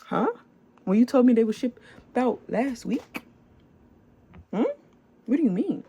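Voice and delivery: can't believe what he's hearing; incredulous. Real, not acted. Reacting to broken shipping promise.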